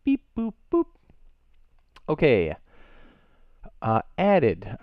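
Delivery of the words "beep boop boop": robot beeps. Pretend. Playful noise starting the list.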